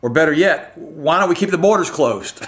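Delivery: fed-up sarcastic jab with pitch rising; frustrated sarcasm. Real, not acted. Ranting to viewers about borders, exasperated sarcasm. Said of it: "I'm just very frustrated right now".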